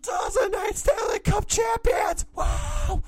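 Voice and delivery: hyped announcer yell; announcer shout. Real, not acted. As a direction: bellow it like an arena announcer. Announcing the champions.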